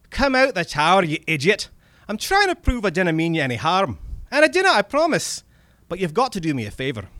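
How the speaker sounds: loud, high, impatient Scottish voice; Scottish accent. Acted. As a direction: insist loudly as an exasperated Scotsman. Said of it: "my bad Scottish accent comes in now".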